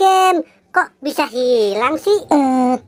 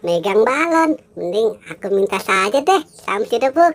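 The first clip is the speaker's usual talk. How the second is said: a lightly lowered cartoon figure humming; lower character voice; 7 semitones lower than usual. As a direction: noticeably lower toy figure, curious. Squid Game character voice. (suara tokoh agak rendah)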